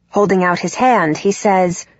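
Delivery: usual speaking voice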